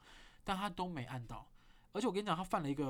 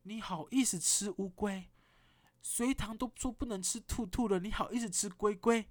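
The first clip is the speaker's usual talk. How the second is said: slow, higher, indignant cutesy protest; mock-scandalized; 5 semitones higher, 3 dB louder than usual. Refusing the friend's turtle jelly, mock horror. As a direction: scold a friend for eating a turtle. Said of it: "我就说".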